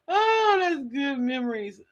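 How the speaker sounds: ordinary delivery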